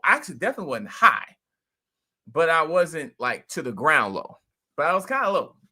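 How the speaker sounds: ordinary talk, nothing marked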